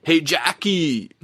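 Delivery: mock Philly accent with a Southern California vibe. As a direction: stretch it out slowly in a put-on Philly accent. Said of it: "Southern California vibe to the Philly accent".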